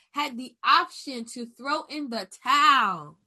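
loud, high preaching cry that rises on each come on; full-force sermon, pushing each phrase out loud and high. Real, not acted. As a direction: preach it like a revival pulpit. Preaching build on option.

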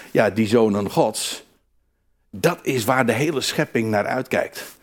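ordinary talk

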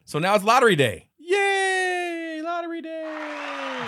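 high, loud whoop, dragging out lottery day; mock cheer. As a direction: cheer like a giddy townsperson.

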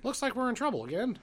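put-on high, gee-whiz kid voice; wholesome 1950s kid. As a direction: innocent fifties kid in trouble.

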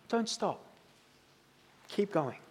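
hushed, gentle don't stop; soft urging. Acted. Preacher voicing God to the congregation, hushed and gentle. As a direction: drop almost to a whisper, urging on. God's quiet encouragement quoted.

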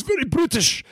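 high-pitched British attempt sliding into Irish; botched British accent. Shown as a demonstration. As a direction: exaggerate a British accent, badly. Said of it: "and that was more Irish".

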